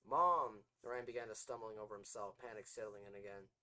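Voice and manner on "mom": loud shout; calling out. A narrator's reading. Orion shouts for his mom. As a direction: yell it across the yard.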